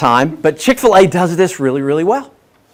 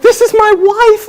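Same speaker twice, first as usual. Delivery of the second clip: much higher and louder, a squealing fan cry; fangirl squeal; 5 dB louder than usual. Retold or imitated. Meeting his hero Jesse Cole at the bar, starstruck squealing. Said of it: "I'm so excited".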